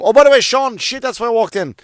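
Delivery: high, loud burst of remembering; excited, high-pitched interjection; sudden realization. Real, not acted. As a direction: blurt it out as it hits you. Suddenly remembers why he came.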